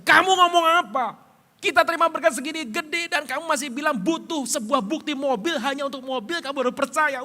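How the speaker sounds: high, loud furious father; angry outburst. Retold or imitated. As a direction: explode at the son, high and loud. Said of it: "Saya naik darah".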